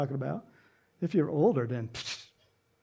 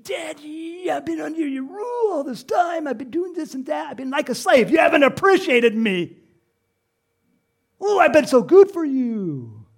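high, loud aggrieved tirade; hammed-up angry whining; 10 semitones higher, 9 dB louder than usual. Older son complains to daddy of slaving, angry. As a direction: pile up complaints in a high shout. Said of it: "he's angry, and he says this".

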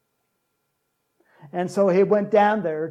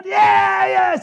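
high shouted stadium yell, louder; fan cheering; 14 semitones higher, 10 dB louder than usual. Retold or imitated. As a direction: belt it out like a fan in the stands. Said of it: "you go".

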